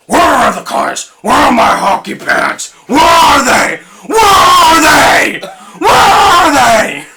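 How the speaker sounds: escalating yelled demand; angry Batman shout. Retold or imitated. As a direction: yell it, building to a scream. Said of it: "Batman impression".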